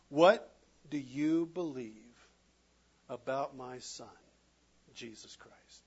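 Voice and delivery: voice of God. Acted. God voiced asking final question.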